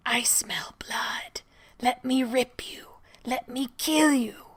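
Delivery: slow, sinister breathy whisper from the walls; creepy whisper. Acted. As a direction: hiss it out like a hungry hidden monster. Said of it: "It's that creepy whisper you did".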